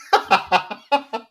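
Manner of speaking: high, real laugh; amused rising laugh. Real, not acted. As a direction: burst out laughing. Laughing at the comment.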